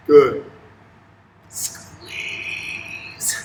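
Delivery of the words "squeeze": whispered squeeze. Shown as a demonstration. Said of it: "Act like you're hugging".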